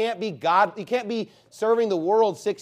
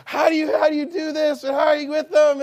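high, loud mock amazement; hammed-up astonished onlooker; amazed shopper; 9 semitones higher, 6 dB louder than usual. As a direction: play the gawking stranger at the store, loud and high. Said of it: "they're just like".

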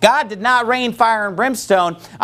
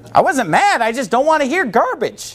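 ordinary delivery

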